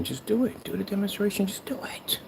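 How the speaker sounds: whispered goading chant; teasing whisper. Pretend. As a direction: hiss the dare softly. Taunting repeated push.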